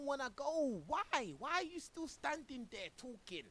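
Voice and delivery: high-pitched incredulous questioning; exasperated disbelief. Real, not acted. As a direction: plead for an explanation in exasperation.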